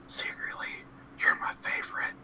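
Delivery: secretive, hushed whisper. Acted. Whispering secretly to a favorite book, whispered. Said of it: "like, whisper to it".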